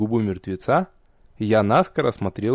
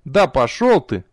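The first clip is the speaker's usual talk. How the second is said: mildly huffy brush-off; understated sulky irritation; grumpy retort; 3 semitones higher, 3 dB louder than usual. A narrator's reading. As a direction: snap back, a touch offended. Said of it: "буркнул мгновенно насупившийся парнишка".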